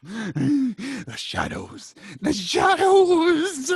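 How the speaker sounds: slow, high and loud crazed wail; crazed man. Acted. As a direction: drag out the words like a madman. Crazed man repeats line.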